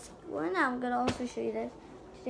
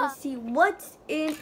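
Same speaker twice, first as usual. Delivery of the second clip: ordinary talk, nothing marked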